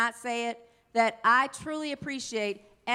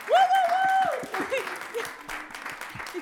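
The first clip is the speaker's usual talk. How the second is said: excited whoop; 13 semitones higher, much slower than usual. Real, not acted. Pastor whooping to rouse the congregation's wimpy clap, excited cheer.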